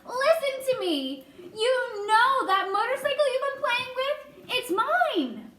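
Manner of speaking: high, insistent calling out; as loud as possible. A narrator's reading. Mouse claims his motorcycle from the boy, calling out. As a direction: raise pitch and voice to be heard. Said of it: "said as loud as he could".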